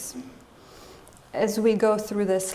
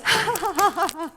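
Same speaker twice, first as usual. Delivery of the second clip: defiant mock laugh; 9 semitones higher than usual. Sister mocking her dad with an exaggerated defiant laugh. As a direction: fake a laugh right at your father. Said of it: "she stomps over there and she goes".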